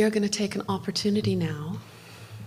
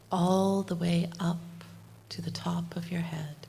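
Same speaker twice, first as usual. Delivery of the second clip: guided meditation; 4 dB quieter than usual. Acted. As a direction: lead them gently into calm, half whispered. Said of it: "do a little bit of guided meditation".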